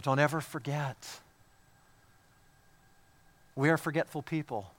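soft, low-voiced reminder; almost whisper the promise to them; hushed preaching. Father quietly telling his son about the crossing, hushed. Father's reassurance, then exhortation.

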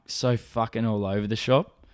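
usual speaking voice

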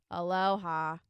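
flat, joyless mock greeting; boring droning parody. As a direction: say it like the most miserable lunch lady. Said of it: "in the most uncheerful, pathetically boring voice".

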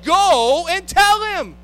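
commanding call. Acted. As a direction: send them off firmly to find him.

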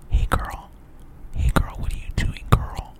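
soft whisper right into the mic; breathy whisper as if in their ear; right in your ear. Shown as a demonstration. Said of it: "I want it to sound like I'm, like, right in your ear".

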